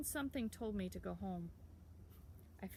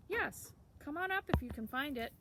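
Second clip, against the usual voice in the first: much higher, louder call after someone leaving; 7 semitones higher, 4 dB louder than usual. A narrator's reading. Sam calling after the boy, high and loud.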